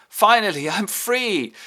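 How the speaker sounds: loud, high-pitched cry of relief; cheering. Retold or imitated. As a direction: cheer as if just released. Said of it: "Homburg cheers".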